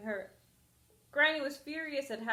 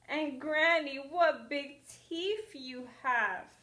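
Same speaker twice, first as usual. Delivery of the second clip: higher, louder, slow fear; frightened girl; 5 semitones higher, 6 dB louder, slower than usual. Acted. Girl sees the big teeth, frightened. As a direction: sound scared of the teeth.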